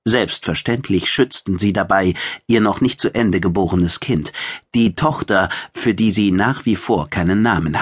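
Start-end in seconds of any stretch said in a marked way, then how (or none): none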